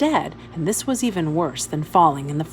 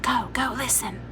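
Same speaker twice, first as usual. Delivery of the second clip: softer, hurried urging; urgent hush; 3 semitones higher, 7 dB quieter than usual. A narrator's reading. Girl in the car urging her brother to go listen, hushed. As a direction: nudge him on under your breath.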